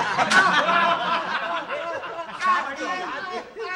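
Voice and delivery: long loud high laugh; big laugh. Real, not acted. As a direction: burst out laughing at the joke. Laughs at his own joke.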